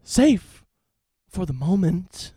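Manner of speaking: loud, high, hammy villain taunt, slow at the end; game villain. Acted. Villain taunts the hero about his daughter, hammy loud sneer. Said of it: "I will be Alex Mercer".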